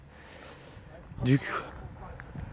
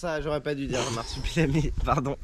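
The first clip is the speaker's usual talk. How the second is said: sheepish apology; 9 dB quieter than usual. Real, not acted. Sheepish apology after silly word.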